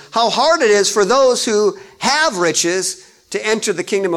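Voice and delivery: loud sermon reading; preaching at full force. Acted. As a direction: declaim the verse to the pews.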